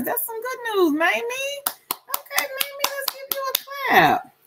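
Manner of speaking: high, bright cheering for the good news; excited cheer. Real, not acted.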